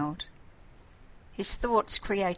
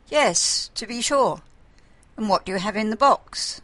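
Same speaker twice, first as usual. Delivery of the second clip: put-on curious old father; 4 semitones higher, 7 dB louder than usual. A narrator's reading.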